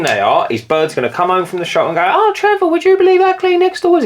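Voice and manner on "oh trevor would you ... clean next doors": very high, squeaky imitation of the gossiping wife; mock wife voice. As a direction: shoot way up into a high fake wife voice, all wide-eyed amazement.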